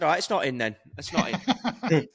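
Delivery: mock retraction through a laugh; laughing tease. Teasing the doubting lads, laughing mock retraction. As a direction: take it back while laughing.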